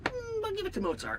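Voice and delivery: high-pitched mocking imitation; dismissive judges. Retelling them crediting Mozart, mocking. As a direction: mimic them in a high, silly voice. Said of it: "they were like".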